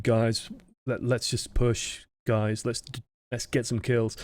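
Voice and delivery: slow, halting, dejected shot call; half-hearted, downcast urging. Shown as a demonstration. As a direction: sound defeated, softly trailing. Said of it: "a little bit dejected".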